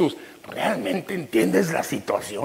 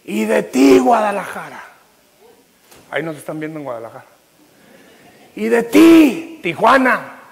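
loud, soaring pulpit preaching, words stretched; preaching at full force; 4 semitones higher, 10 dB louder than usual. Acted. Pastor preaching to the congregation, at full force. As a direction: preach it out to the whole church. Calling out to cities.

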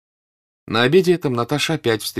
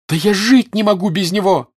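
high, loud cry of passion; impassioned high-pitched yell; desperate shout; 11 semitones higher, 8 dB louder than usual. A narrator's reading. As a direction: cry out in despair. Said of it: "закричала Наташа".

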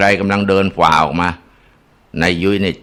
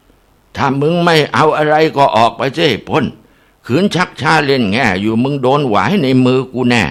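stern threatening monk; 6 semitones higher than usual. A narrator's reading. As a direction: drive the spirit out with a hard warning. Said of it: "ท่านก็เลยหันมาใช้ไม้แข็ง".

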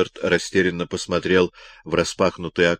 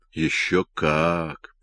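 usual speaking voice

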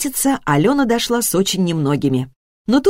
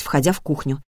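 ordinary delivery, nothing marked

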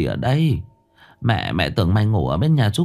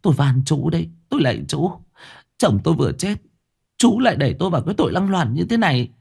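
higher, rushing, pleading woman; frantic pleading widow, climbing higher; desperate pleading; 7 semitones higher, faster than usual. A narrator's reading. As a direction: beg him, rising in panic.

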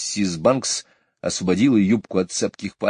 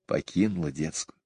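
ordinary delivery

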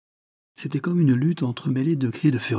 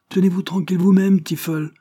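loud, raised-pitch reprimand; stern interruption; 9 semitones higher, 6 dB louder than usual. A narrator's reading. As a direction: cut in sharply to silence a servant. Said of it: "interrompit monsieur Lester sévèrement".

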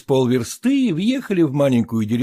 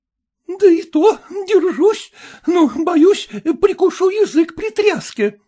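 wife's voice; 12 semitones higher than usual. A narrator's reading. Wife answering her husband in the bumpy cart, high lady's voice. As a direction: answer while jolted in the cart.

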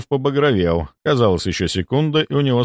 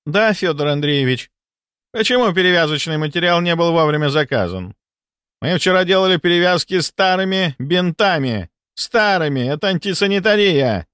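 acted scolding exchange; 9 semitones higher than usual. A narrator's reading. Scolding exchange, boss and elderly nurse.

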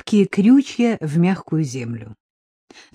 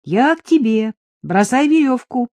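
high call through cupped hands; raised, carrying shout; 6 semitones higher, slower than usual. A narrator's reading. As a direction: holler to someone far away. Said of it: "сложила ладони у рта и крикнула".

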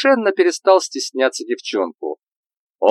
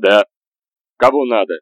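barking; 5 dB louder than usual. Acted. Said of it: "рявкнул".

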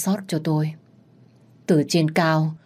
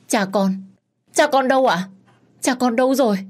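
1 desperate cry; 8 semitones higher, 3 dB louder than usual. A narrator's reading. Daughter searching for father in fire.

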